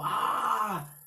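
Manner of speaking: high, loud "aah" cry of a possessed man; grito de poseído. Acted. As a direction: cry out like an unclean spirit. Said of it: "que dio voces diciendo". (grito agudo de endemoniado)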